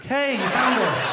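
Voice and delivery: slow, laughing fake-friendly greeting; fake cheer. Brit couple faking delight at unwanted friends, forced cheer.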